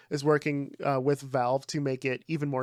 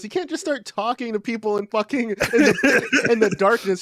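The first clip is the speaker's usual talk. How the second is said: high, loud mock scolding; scolding wife; 8 semitones higher, 6 dB louder than usual. Wife scolding husband for talking in the dark, high mock protest. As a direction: imitate a rattled, exasperated telling-off.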